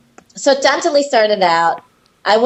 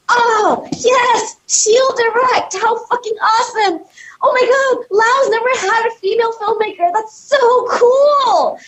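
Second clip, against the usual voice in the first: put-on drunk producers; 14 semitones higher than usual. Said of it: "And everybody was like".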